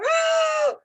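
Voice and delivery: mock scream, Ah very high and loud. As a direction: belt out a shrill mock yell. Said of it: "started screaming at him in this patois".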